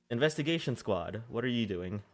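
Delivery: ordinary talk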